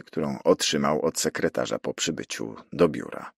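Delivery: plain reading voice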